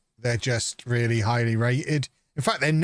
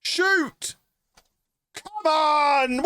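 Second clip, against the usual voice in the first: excited shout; much slower than usual. Real, not acted. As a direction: yell at the screen, thrilled. Cheering a goal in game.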